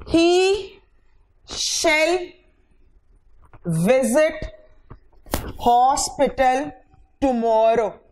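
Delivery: drawn-out classroom example; spaced words for students; slow dictation. Shown as a demonstration. Teacher dictates example sentence.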